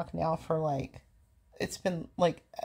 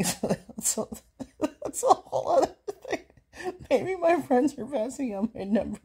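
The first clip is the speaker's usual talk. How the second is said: high laughing voice, louder; 8 semitones higher, 5 dB louder than usual. Real, not acted. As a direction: laugh through the words. Laughing at own silly idea.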